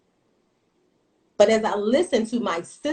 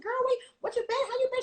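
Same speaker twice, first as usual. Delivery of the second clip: much higher, gushing with joy; excited reunion; much faster than usual. Retold or imitated. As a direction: greet an old friend with delight, pitched way up. Retold joyful reunion with sister.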